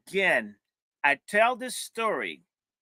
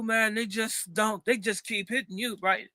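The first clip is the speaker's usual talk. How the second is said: ordinary delivery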